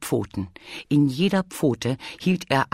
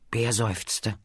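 ordinary delivery

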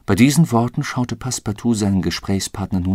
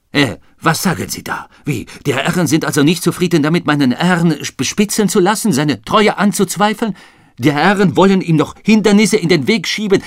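indignant outburst; 8 semitones higher, 6 dB louder than usual. Acted. Passepartout indignant at the detectives.

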